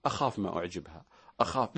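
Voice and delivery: whispered, low nervous worrying; anxious groom. Retold or imitated. Host imitating a nervous man before marriage, whispered and fearful. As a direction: fret under your breath. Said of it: "تكون قلقا".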